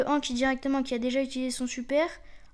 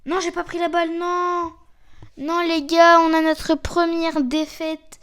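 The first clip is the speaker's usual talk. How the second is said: raised voice, building to a loud lament; real dismayed outcry; 4 semitones higher, 8 dB louder than usual. Real, not acted. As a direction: cry out in dismay at losing. Misses ball, suffers first defeat. (cri de dépit, de plus en plus fort)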